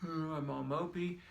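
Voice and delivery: lightly acted moping grumble; mock mopey. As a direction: play someone slumped in a mopey mood. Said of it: "attitude can affect our posture".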